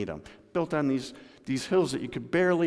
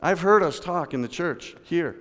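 usual speaking voice

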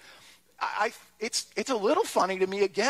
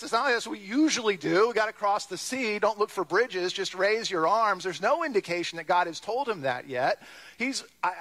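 normal talking voice

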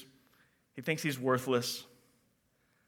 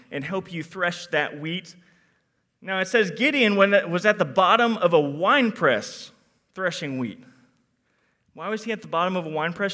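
ordinary talk, nothing marked

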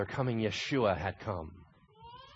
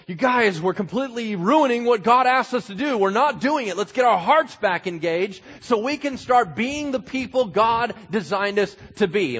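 loud, high, slowed plea to get back on track; a prophet begging the nation to return; pleading prophet; 10 semitones higher, 8 dB louder than usual. Acted.

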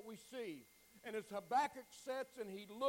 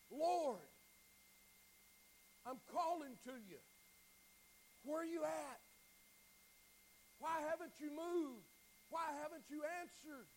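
a raised, searching call to God; pleading call; 5 semitones higher than usual. Retold or imitated. Preacher voicing Habakkuk calling to God, pleading call. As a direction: call out to God, asking not yet screaming. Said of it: "is a call".

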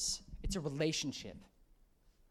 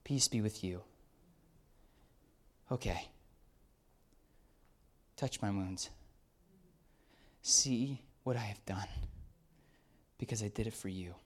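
gentle Jesus; 7 semitones lower, 4 dB quieter than usual. Acted. Jesus greets doubting disciples.

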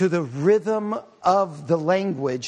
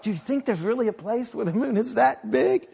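imitating my wife; 3 semitones higher than usual. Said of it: "she was like".

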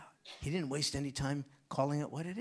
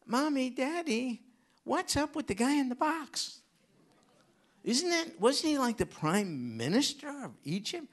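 put-on high, curious kid voice; child's voice; 9 semitones higher, 6 dB louder than usual. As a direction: ask like a wide-eyed child. Child voice asking parents.

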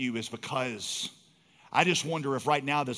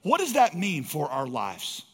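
higher and louder, driving the point home to the congregation; preaching at full force; 6 semitones higher, 5 dB louder than usual. Acted. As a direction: build to a fervent pulpit cry, slowing on key phrases. Preaching climax.

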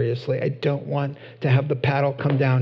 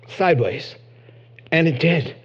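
ordinary delivery

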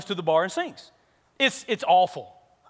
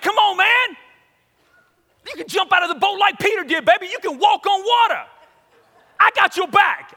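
high, loud dare to a buddy; raised, urgent goading; hyped-up egging on; 8 semitones higher, 5 dB louder than usual. Retold or imitated. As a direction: pump him up like a rowdy pep talk. Friend eggs him on to rap.

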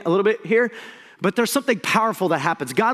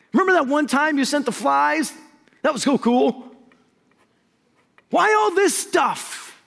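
mock complaining; 8 semitones higher than usual. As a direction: grumble like a spoiled crowd. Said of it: "the people complained".

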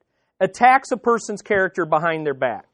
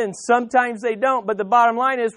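normal talking voice

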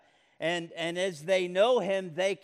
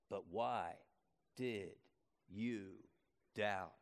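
soft, low, slow question; quiet question; 9 semitones lower, 11 dB quieter than usual. Acted. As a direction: hush down and ask it slowly. Repeats Jesus' question quietly.

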